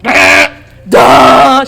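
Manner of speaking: very loud, high horn blast; blaring horn imitation. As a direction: blare it like a bull moose in heat. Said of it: "she just blared it out".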